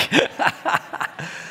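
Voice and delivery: soft breathy chuckle; quiet unvoiced chuckle; laugh. Real, not acted. Laughing at fans.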